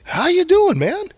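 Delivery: squeaky falsetto greeting; silly high voice. Acted. Host greets the guest in a squeaky falsetto. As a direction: pop up into a goofy high falsetto.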